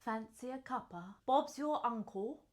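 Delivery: put-on English accent. Player in accent roulette, put-on English accent.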